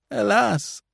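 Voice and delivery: high falling alas, lightly sobbed; a touch of a sob on alas; mild sob. A narrator's reading. Grieving priest laments the Countess, high mild sob. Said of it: "he sobbed".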